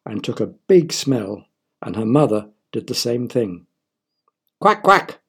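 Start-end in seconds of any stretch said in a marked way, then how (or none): 4.6-5.2 high duck quacks; father quacking. A narrator's reading. A father quacking after smelling the flower. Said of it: "said her father".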